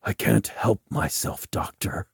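usual speaking voice

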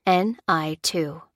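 ordinary delivery, nothing marked

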